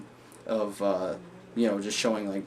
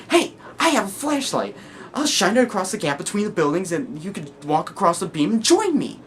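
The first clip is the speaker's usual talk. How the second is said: higher, bright-idea call across a gap; eager madman; 9 semitones higher, 6 dB louder than usual. Acted. First guy offering his flashlight beam, eager calling.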